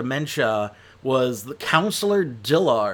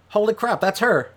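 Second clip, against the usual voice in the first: sudden surprise; 9 semitones higher than usual. Real, not acted. Reviewer suddenly recognizing the actress, surprised. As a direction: blurt it out as it clicks.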